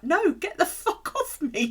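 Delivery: high, slow laughing shout; high laughing get off me; laughing yell. Retold or imitated. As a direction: recoil with a laughing no. Recoiling at foot touch.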